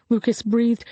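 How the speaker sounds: usual speaking voice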